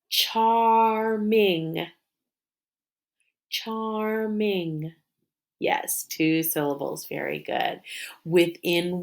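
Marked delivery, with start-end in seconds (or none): 0.1-1.9 "Charming" drawn out as char, ming; tapped syllables. Shown as a demonstration. Teacher tapping together with pupils, "Charming" said syllable by syllable. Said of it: "Tap it". 3.5-4.9 "Charming" softer, drawn out as char, ming; tapped syllables. Shown as a demonstration. Teacher repeating the tapped word for pupils, "Charming" syllable by syllable. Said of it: "Tap it".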